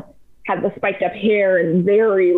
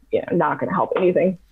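ordinary talk, nothing marked

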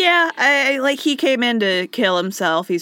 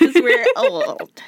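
laughing echo; 8 semitones higher, 4 dB louder, slower than usual. Real, not acted. Echoing co-host's joke.

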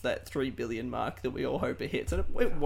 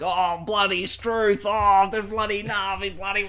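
loud, drawn-out mock Aussie bluster; exaggerated Australian accent; 5 semitones higher, 7 dB louder than usual. Host mocks the Australian dude among Americans, hammed-up Australian accent. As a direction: ham up a broad Australian accent, high and loud. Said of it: "hearing an Australian accent".

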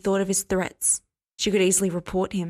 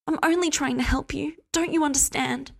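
higher, pitying plea; 8 semitones higher than usual. A narrator's reading. Hester pitying Cass, pleading in a higher voice. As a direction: pity him gently. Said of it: "She shook her head pityingly".

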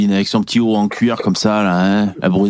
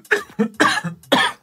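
a rough, hacking cough; coughing fit; 8 semitones higher than usual. Real, not acted. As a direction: cough hard into the mic. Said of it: "Excusez-moi, je tousse".

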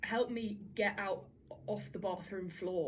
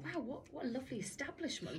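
amused inner voice; 3 semitones higher, 9 dB quieter than usual. Retold or imitated. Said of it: "And I thought".

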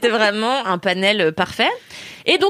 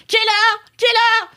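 higher mock call; 12 semitones higher than usual. Woken from a nap, calling out high to the intruder. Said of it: "Et je dis".

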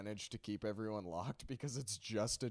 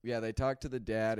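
ordinary talk, nothing marked

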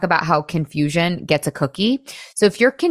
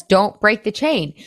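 ordinary talk

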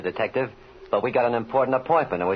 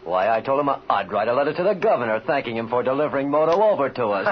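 normal talking voice

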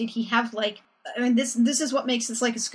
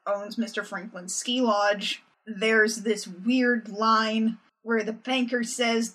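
normal talking voice

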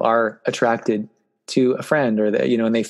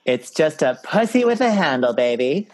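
camp quip; 4 semitones higher than usual. Acted. Camp punchline.

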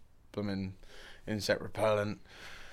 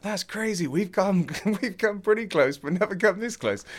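amazed villagers; 11 semitones higher, 5 dB louder than usual. Retold or imitated. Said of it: "they were like".

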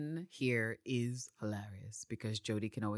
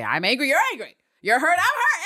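very high, loud, exaggerated quote; mocking recital; 11 semitones higher, 9 dB louder than usual. Podcaster mocks Laura Neal's writing, high and hammed-up. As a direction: parody empty dialogue at top pitch.

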